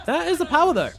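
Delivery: high, loud shout; excited shout. Real, not acted. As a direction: yell it out in hype. Big teamfight kill.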